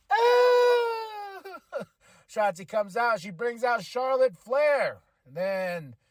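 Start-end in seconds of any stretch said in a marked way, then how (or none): 0.1-1.8 sudden high-pitched scream of shock; shocked high shriek. Real, not acted. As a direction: shriek in delight at the burn. Reaction to the insult.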